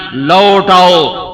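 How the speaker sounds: slow, longing لوٹ آؤ held long; drawn-out plea. Shown as a demonstration. Preacher showing the yearning in the word, slow plea. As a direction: stretch لوٹ آؤ as if calling someone home. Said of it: "اس میں انتظار کا مطلب چھپا ہوا ہے".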